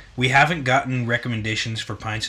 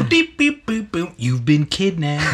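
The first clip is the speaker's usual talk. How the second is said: silly clown; 6 semitones higher, 4 dB louder than usual. Pretend. Kidnapping bit with guest.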